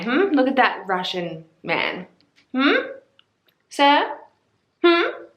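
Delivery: high playful "Hmm?" prompts, fangirling; flirty teasing. Real, not acted. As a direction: coo at the picture like a flirt. Swooning over a book hero.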